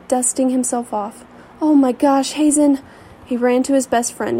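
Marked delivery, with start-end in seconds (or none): 1.6-2.8 loud, high happy surprise; excited. Acted. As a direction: burst out in relief. Friend surprised to see her.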